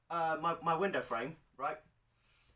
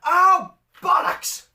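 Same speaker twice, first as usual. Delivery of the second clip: sudden high shout of frustration; mum's shout; 12 semitones higher, 16 dB louder, much slower than usual. Retold or imitated. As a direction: blurt it out loud like a cursing mum. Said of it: "Next thing I hear is her shout".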